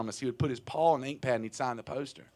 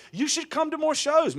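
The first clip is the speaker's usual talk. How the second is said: high, level pitched-up mimicry; high quoted plea; 13 semitones higher, 4 dB louder than usual. An invite from early courtship, high-pitched imitation.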